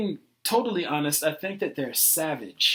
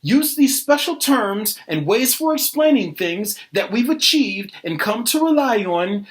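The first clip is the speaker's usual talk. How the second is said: loud, insistent instruction; heated lecturing; 3 semitones higher, 5 dB louder than usual. Acted. Arguer lecturing a doubter, loud and insistent. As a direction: lay it down forcefully at normal pace.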